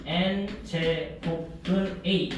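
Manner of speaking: very slow, syllable by syllable; model reading. Shown as a demonstration. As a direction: spell out "N 제곱근 A" in slow, separate beats. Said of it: "N 제곱근 A라고 읽어야 돼".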